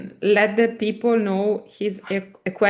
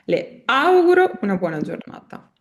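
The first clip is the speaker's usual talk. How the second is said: "auguro" stressed on the opening A; 3 semitones higher than usual. Shown as a demonstration. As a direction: lean on the A of "auguro". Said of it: "fate cadere l'accento sulla A".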